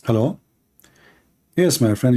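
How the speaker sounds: usual speaking voice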